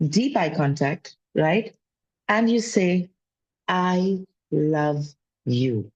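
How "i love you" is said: very slow, low, deliberate I love you; slow mirror affirmation. Shown as a demonstration. Speaking to her own reflection each morning, slow and sincere. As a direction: say it slowly to yourself in the mirror. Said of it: "You don't have to believe it".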